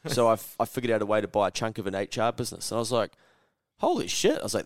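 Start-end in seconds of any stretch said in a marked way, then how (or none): 3.8-4.4 amazed reaction. Retold or imitated. Retells amazed reaction to the story.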